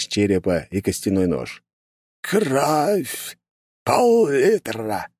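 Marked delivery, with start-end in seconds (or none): 2.2-5.1 put-on high, slow, creepy old sorcerer; eerie voice. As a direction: drag each word out like a ghoulish old man. Sinister old curse-maker voiced.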